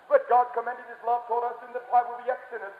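ordinary delivery

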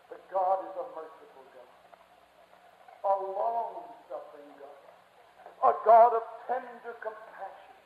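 slow, low, awed reverence; tender, hushed preaching. Real, not acted. Preacher describes a merciful God, hushed reverence. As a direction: savour each phrase softly and slowly.

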